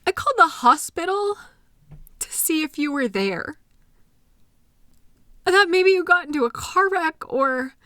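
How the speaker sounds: anxious pleading. Acted. Partner recounting fearful night.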